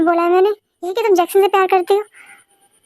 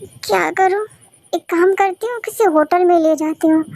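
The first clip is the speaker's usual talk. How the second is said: normal talking voice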